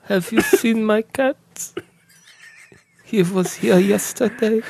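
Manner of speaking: put-on high, slow, sinister German accent; politely threatening, drawn out; creepy German voice. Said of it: "it's creepy".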